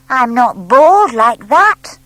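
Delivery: loud, slow, offended little owl; mock-offended owlet; indignant. A narrator's reading. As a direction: protest hotly as a small owl. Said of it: "he said indignantly".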